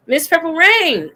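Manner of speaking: ordinary delivery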